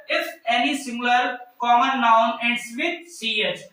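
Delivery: slow, deliberate rule dictation. Shown as a demonstration. Teacher dictating the rule slowly to her class. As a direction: spell the rule out word by word. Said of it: "teacher dictating the rule".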